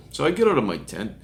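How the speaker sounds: ordinary delivery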